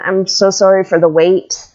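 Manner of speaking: ordinary delivery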